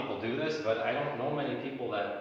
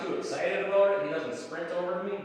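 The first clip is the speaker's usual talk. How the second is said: usual speaking voice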